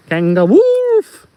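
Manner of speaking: loud, high, slow howl; howled made-up animal name; wolf-howl punchline. Acted. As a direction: stretch the last word into a wolf howl.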